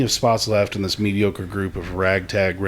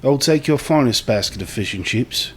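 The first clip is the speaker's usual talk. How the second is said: put-on English accent; 2 semitones higher than usual. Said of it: "That was pretty good, [name]".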